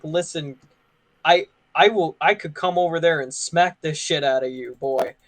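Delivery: usual speaking voice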